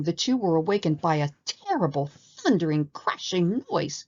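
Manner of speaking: ordinary delivery, nothing marked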